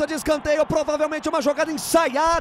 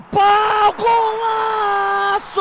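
high, drawn-out goal cry; goal shout; 6 semitones higher, much slower than usual. Real, not acted. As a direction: stretch out the golaço. Goal call on corner kick.

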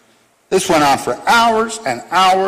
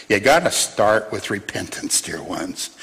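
hushed appeal; 8 semitones lower, 6 dB quieter than usual. Real, not acted. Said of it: "intimate pastoral appeal".

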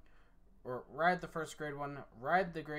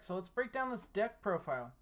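usual speaking voice

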